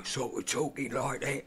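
lightly voiced old man; a hint of elderly character voice; briefly hint at an old man; eighty-seven-year-old man. Shown as a demonstration. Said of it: "eighty-seven-year-old man".

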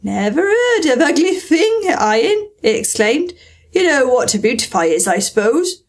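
high, loud astonished exclaim. A narrator's reading. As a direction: react in total disbelief. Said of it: "lifted up both its paws in surprise".

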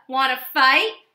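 loud taunting question; loud grouchy ladybug dare; grouchy challenge. Acted. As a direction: dare them to fight.

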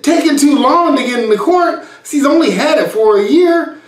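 high, loud, laughing disbelief; incredulous. Retold or imitated. To a partner firing their lawyer, laughing disbelief. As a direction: scoff at the absurd excuse.

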